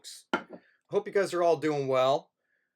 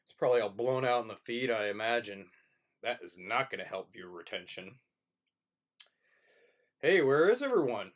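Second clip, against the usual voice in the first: usual speaking voice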